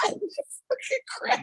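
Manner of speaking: high, slow panicked cry; comic high-pitched panic; character's panicked yell. Retold or imitated. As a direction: squeal it out like a scared character. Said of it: "and you hear".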